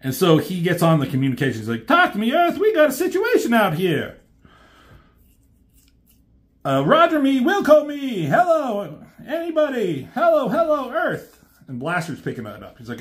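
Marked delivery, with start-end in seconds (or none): 1.9-11.4 high, urgent call for help over the radio; high cartoon robot calling base; panicked radio call. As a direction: shout into a dead radio, desperate. Jazz radioing from the moon base.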